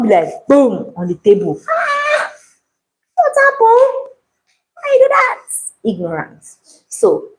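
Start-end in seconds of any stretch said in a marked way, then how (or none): 1.7-5.4 high, pleading mock cry; mock dismay. Baker scolding herself over the bread, high mock dismay. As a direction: squeal it like you're hurt.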